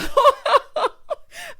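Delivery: loud, high burst of laughter; big cackle. Real, not acted. Cracks up at Tootie nickname.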